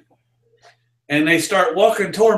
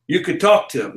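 ordinary talk, nothing marked

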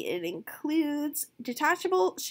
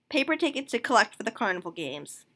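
ordinary talk

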